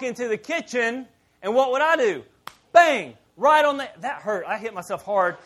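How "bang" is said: bang sound. Retold or imitated. Hitting head on cabinet.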